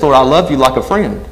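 ordinary delivery

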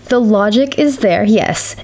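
normal talking voice

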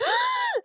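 sharp high gasp of disgust; shocked gasp. Retold or imitated. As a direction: gasp like you just saw nits. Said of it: "everyone behind her was like".

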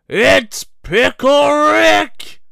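high, slow, loud cartoon shriek; Pickle Rick impression. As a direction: belt it out like a manic cartoon scientist. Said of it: "I could do Rick".

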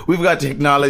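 playful mimicking repeat; teasing imitation. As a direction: parrot her words with a laugh. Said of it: "I like how you said that".

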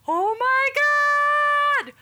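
hammed-up panic; mock panic. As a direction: wail it like the world is ending. Retold panic while Googling diagnosis.

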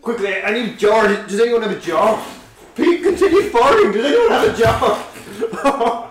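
loud, high urgent calls; frantic. Pretend. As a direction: beg for help, panicked. Panicked call for a jar.

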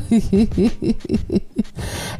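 rapid airy giggle. Real, not acted. Podcaster wrapping up for listeners, giggling. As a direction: giggle away to yourself. Giggles after playful what chant.

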